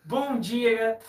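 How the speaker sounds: loud, overdone greeting, mimicking a friend; Mika, forced greeting. Friend imitates Mika straining to greet his followers, forced. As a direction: put on a forced, too-loud hello. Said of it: "Ele fica forçadão, né, mano?".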